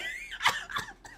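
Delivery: high squealing burst of laughter; shrieking laugh. Real, not acted. Cracking up at mom's story, high squealing laugh. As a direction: let a shrill laugh rip.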